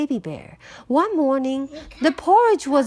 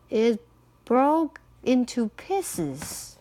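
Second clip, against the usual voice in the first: plain reading voice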